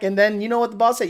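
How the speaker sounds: normal talking voice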